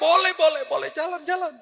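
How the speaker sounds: high, quick insistence to drive on; hurried urging. Retold or imitated. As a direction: push him to start driving. (desakan cepat dengan suara tinggi)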